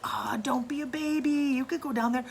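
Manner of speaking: mock-reassuring siblings egging him on; coaxing. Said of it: "We're like".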